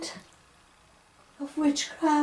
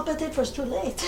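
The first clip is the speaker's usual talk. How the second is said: usual speaking voice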